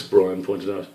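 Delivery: ordinary delivery, nothing marked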